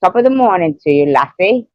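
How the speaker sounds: quick, lightly done Irish accent; Irish accent attempt. Shown as a demonstration. Friend trying out an Irish greeting, fast and lightly accented. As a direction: rattle it off in a hint of an Irish brogue. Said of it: "that's my attempt at Irish".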